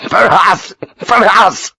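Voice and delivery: high, laughing repeat; barking laughter. Acted. Major repeating Sharp's words while laughing. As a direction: bark it out between laughs. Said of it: "started his strange barking again".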